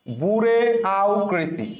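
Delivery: "বুড়ে", pause, "আউ ক্রেসি"; memory-trick pronunciation. Shown as a demonstration. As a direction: split it as boo-ray, then au-cracy. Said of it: "তাহলে সঠিক উত্তর কোনটা?".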